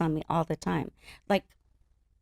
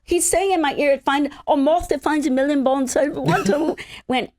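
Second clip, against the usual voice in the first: high, loud, flustered protest; flustered retelling; 8 semitones higher, 6 dB louder than usual. Retold or imitated. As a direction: blurt it out in disbelief. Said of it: "I said".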